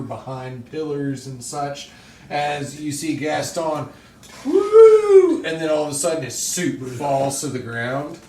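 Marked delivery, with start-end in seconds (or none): 4.5-5.4 high loud woo for the blast; explosion whoosh. Acted. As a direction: whoop out the explosion. Narrating explosion, sound effect.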